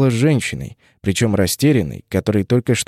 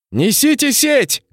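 high, slow barked order; commanding shout; 14 semitones higher, slower than usual. Acted. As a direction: order the net brought. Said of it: "приказала капитанша".

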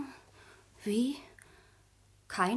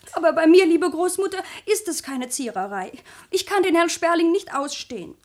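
much louder, firm protest; loud insistence; 3 semitones higher, 8 dB louder than usual. Acted. Sabine insisting to her grandmother she hates the suitor, loud. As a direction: push back hard.

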